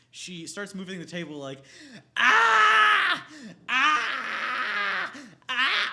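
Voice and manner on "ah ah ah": loud high shrieks; screaming. Retold or imitated. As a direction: scream at the top of your lungs. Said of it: "screaming at the top of her lungs".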